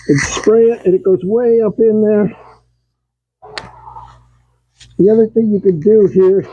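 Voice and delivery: speaking through a respirator mask; words muffled behind a respirator. Real, not acted. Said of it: "Now I'll be a little muffled with the respirator on".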